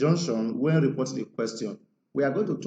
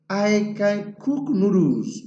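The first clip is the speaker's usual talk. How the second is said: higher, slow, voiced as Ibrahim; quoting Ibrahim; 6 semitones higher, 4 dB louder, slower than usual. Shown as a demonstration. Teacher reads Ibrahim's quoted claim, higher and slow. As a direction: lightly play Ibrahim boasting. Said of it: "said Ibrahim".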